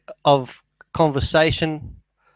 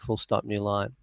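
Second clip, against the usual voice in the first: softer aside dictating punctuation; 5 semitones lower, 9 dB quieter than usual. Real, not acted. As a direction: drop the voice for the punctuation commands.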